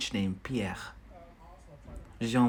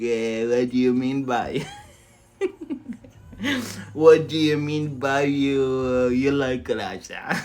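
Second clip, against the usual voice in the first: louder mock outrage broken by laughter; imitating Olga; 5 dB louder than usual. Imitating student Olga objecting, loud and laughing. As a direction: play the offended Ukrainian viewer, laughing. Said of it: "Olga would be like".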